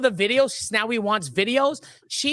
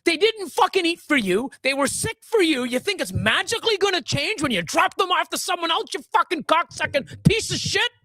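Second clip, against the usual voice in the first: furious rant; 6 semitones higher than usual. Real, not acted. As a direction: tear into her, livid. Ranting at the owner directly.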